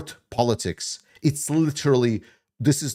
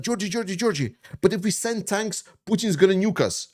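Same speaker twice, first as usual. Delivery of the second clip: high mocking voice of a panicky doubter; mocking critics; 6 semitones higher than usual. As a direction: ape the people who always warn him, pitched high. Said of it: "And then it's like".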